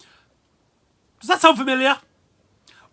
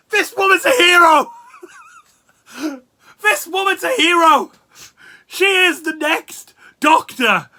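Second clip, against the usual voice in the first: laughing mock praise; 10 semitones higher, 9 dB louder than usual. Real, not acted. Laughing mock tribute to Velma.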